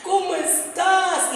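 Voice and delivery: drawn-out, mock-weepy greeting; imitating tearful mother. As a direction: imitate a mother sobbing down the line. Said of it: "mi madre me habla llorando".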